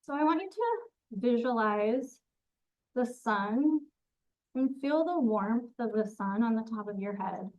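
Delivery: unhurried, even grounding-meditation delivery; guided meditation. Acted. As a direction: guide them gently through a visualization, phrase by phrase. Leads a grounding visualization.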